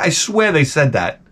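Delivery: ordinary talk, nothing marked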